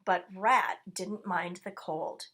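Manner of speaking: usual speaking voice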